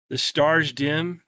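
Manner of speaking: usual speaking voice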